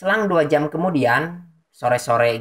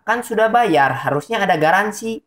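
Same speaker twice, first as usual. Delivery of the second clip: high-pitched miffed retort of a customer; insistent, annoyed; 8 semitones higher than usual. Retold or imitated. Annoyed customer insisting on her warranty to the technician, pushy. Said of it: "dengan nada ngotot dan kesal".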